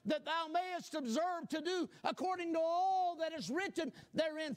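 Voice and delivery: booming preached scripture, rising; fervent preacher reading Joshua; preaching scripture. Acted. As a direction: proclaim the verse from the pulpit. Reads scripture at full force.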